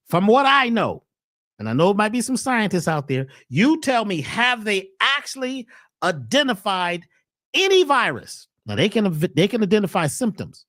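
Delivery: ordinary talk, nothing marked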